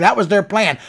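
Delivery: ordinary talk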